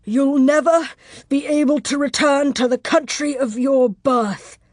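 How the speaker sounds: loud, high, wounded man's threat; savage threat. Acted. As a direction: spit out the curse savagely. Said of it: "he added savagely".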